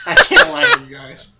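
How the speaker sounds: real laughter through the words; loud, high, helpless laugh while talking. Real, not acted. Confessing to friends it was cringe, laughing.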